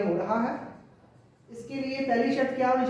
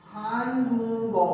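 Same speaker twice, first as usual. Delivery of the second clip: slowly spelled out; much slower than usual. Shown as a demonstration. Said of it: "और ये इधर चला गया".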